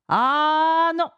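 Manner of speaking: high, slow, drawn-out refusal; crowing old man, savouring each word; gleeful old tease. Acted. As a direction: stretch it out with wicked relish. Old man teasingly keeps the book.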